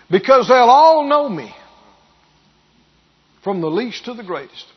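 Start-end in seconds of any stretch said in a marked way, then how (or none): 0.1-1.5 loud, higher, ringing out on ALL; emphatic proclamation. Real, not acted. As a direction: proclaim it loudly, leaning on "all". Preacher stresses scripture promise.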